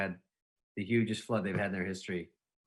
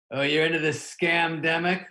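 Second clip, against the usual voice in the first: louder, higher, mocking sneer; a driver scoffing at his passenger; scornful driver; 6 semitones higher, 5 dB louder than usual. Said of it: "he goes".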